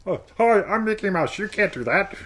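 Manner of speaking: high squeaky cartoon mouse; Mickey Mouse. Shown as a demonstration. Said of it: "either Mickey Mouse or seductive".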